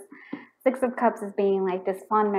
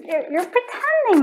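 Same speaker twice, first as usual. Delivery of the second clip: high, loud teasing call-out; excited; 12 semitones higher, 7 dB louder than usual. Retold or imitated. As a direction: catch them out with glee. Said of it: "I get so excited 'cause I was like".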